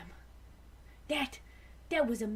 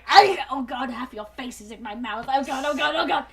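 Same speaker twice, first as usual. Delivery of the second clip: mock panic; 8 dB louder than usual. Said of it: "you try to kiss them and you're".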